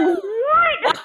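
high, startled "What?"; the husband recoils in disbelief; shocked what. Retold or imitated. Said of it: "He's like".

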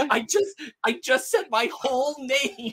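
high, loud comic exclamation; worked-up outburst. Retold or imitated. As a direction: blurt it out loud, stretching the last words. Acting out character's outburst in film.

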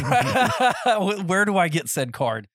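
laughing while asking. Real, not acted. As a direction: talk through real laughter. Laughing at the Texas card joke.

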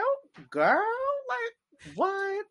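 high squealing mock disbelief, voice cracking up; shocked gasp. Friends hear her squeal over a toxic crush, mock shocked. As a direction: gush like a girl stunned by a crush.

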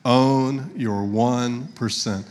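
very slow, drawn-out words; leading in unison. Shown as a demonstration. Preacher saying it with the congregation, very slow and deliberate. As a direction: stretch each word so all can join. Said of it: "Say it with me".

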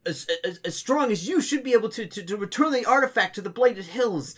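a hint of hopeful young acolytes; earnest acolytes. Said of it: "they say".

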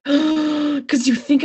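high, slow, mock-tearful squeak; gasp, then squeeze the words out high; choked-up gasp. Co-host recalls the sad scene, high choked voice.

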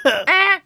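high, short buzzer noise; high-pitched "eh" as a buzzer; mouth-made buzzer. Pretend. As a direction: imitate a game-show buzzer. Buzzes in to answer the quiz.

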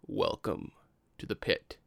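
low, breath-heavy attempt at an ominous greeting; Matt's voice. Game master imitates another's pit greeting, low attempted character voice. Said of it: "I can't do Matt's voice".

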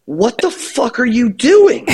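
high-pitched incredulous demand; mock outraged question; imitated exasperation. Retells being caught in line.

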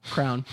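"Crown" for crayon, in one syllable; Indiana pronunciation. Shown as a demonstration. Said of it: "if you're from Indiana".

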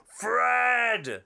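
loud, high call across the street; shout. Retold or imitated. Someone on Main Street shouting after Fred. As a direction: yell his name from afar.

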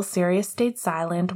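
ordinary delivery, nothing marked

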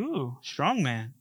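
slow, soft, low admiring coo; impressed shepherdess. Acted. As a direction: lightly play a woman impressed by muscle. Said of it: "she's probably like".

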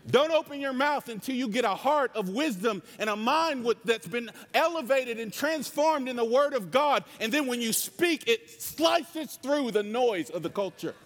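high, driving sermon voice, almost yelling; fervent pulpit exhortation; passionate preaching. Real, not acted. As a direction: preach it with full passion in one long surge. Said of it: "I'm just passionate".

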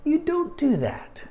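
high, slow, wide-eyed warning; a kid's alarmed "you don't do that"; boyish warning. Retold or imitated. Boy warns the preacher, high and slow. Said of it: "I'm going".

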